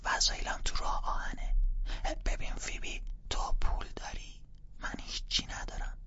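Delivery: urgent hushed whisper. Acted. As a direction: keep it down, parents are home.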